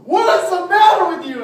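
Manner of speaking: very loud, high-pitched scolding shriek; frantic parents' yell. Retold or imitated. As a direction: shriek it like a panicked parent, acted out.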